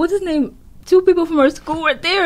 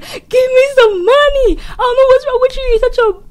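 high, raised-voice mock tirade; hammed-up angry demand, pitched way up; losing it; 9 semitones higher, 4 dB louder than usual. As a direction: imitate a man losing his temper. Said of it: "like, he loses it".

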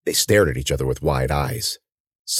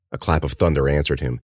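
plain reading voice

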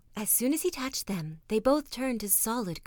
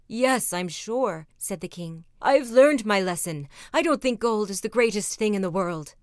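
loud, earnest king who learned his lesson; repentant king; 7 dB louder than usual. Acted. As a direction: declare it with full conviction.

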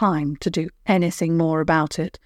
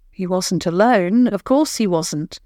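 ordinary delivery, nothing marked

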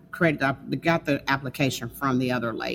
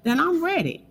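emphatic high quote; 11 semitones higher than usual. Retold or imitated. Repeating her prayer to husband.

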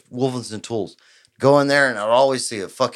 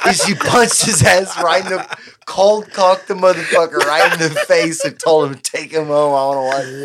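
laughing while telling; 5 semitones higher, 5 dB louder than usual. Real, not acted. Laughing through the punchline.

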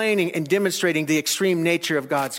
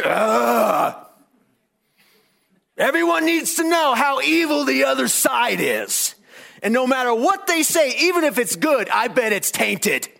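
a hint of a sneering grumbler; bitter complainer; 7 semitones higher than usual. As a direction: whine it like a bitter partisan. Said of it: "But yet so many".

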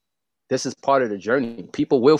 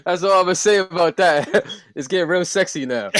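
talking through real laughter, pitched up; high, amused, laughing delivery; 8 semitones higher, 3 dB louder than usual. Real, not acted. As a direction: let the laugh bubble through the words. Laughing with co-host over the remark.

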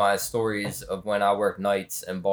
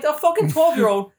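incredulous; 6 dB louder than usual. Real, not acted. Disbelief at twelve-year-old pimp.

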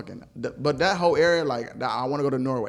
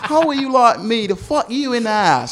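high-pitched hammed-up British request; cheeky fake Brit, loud and high; mock British accent; 9 semitones higher, 8 dB louder than usual. Playing a Brit abroad for laughs, high mock British. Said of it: "I'm a Brit".

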